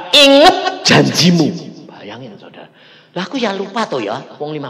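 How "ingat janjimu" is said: slow, loud "Ingat janjimu"; booming, slow "Ingat"; loud command. Acted. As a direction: proclaim it like a voice from heaven. God's voice recalled loudly.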